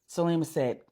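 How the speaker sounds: usual speaking voice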